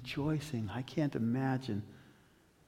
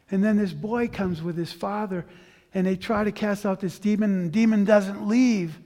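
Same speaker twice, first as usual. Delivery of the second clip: fervent preaching; 5 semitones higher, 7 dB louder than usual. Real, not acted. Preaching at full force.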